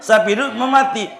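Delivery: a villager staring at a carried cow, baffled; puzzled villager. Retold or imitated.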